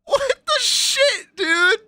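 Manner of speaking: very high, slow, loud squeal; high drawn-out outrage; shrieking disbelief. Real, not acted. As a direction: stretch it out in a shriek. Shrieking at the menu.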